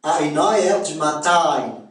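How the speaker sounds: Québécois demo, "heure" as a-eur, "matin" as ma-taïn; Québécois accent. Shown as a demonstration. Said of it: "On va dire quoi?".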